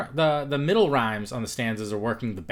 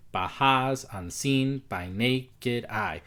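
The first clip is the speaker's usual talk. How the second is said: very slow, beats marked in "Baja's unseen by naked eye"; hard and soft syllables; much slower than usual. Shown as a demonstration. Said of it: "do it with your mouth, speak it".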